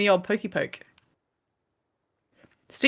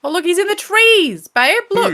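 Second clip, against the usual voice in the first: high, loud, urgent call out; excited shout; 11 semitones higher, 9 dB louder than usual. Real, not acted. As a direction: point it out, thrilled and loud. Spots enemy in the trees.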